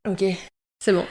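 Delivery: ordinary talk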